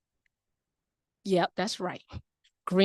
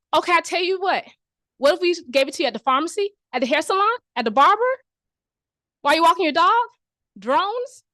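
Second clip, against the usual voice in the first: higher and louder, piling on offers; excited hard sell; 6 semitones higher, 5 dB louder than usual. Acted. Sam the scientist pushing prep everywhere, loud and eager. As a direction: sell it like a pushy salesman.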